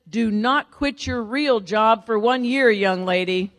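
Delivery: loud, slow paternal order; stern military dad. Retold or imitated. Military father ordering daughter to keep her job, stern. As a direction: lay down the law as father. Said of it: "I grew up in a military family".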